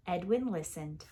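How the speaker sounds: ordinary delivery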